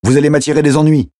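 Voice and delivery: agitated driver, high-pitched; high, exasperated protest; alarmed order. Acted. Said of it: "ordonna-t-il à Matthew".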